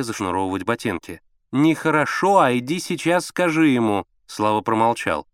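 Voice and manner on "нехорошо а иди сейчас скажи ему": high, louder reprimand; insistent, raised woman's voice; scolding mother. A narrator's reading. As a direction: order him sternly from the next room.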